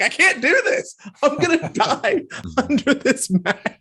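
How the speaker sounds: high-pitched, laughing mock despair; mock panic. Asthmatic actor told to play dead in a mask, mock panic. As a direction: wail it in exaggerated panic, half laughing. Retold panic about holding breath.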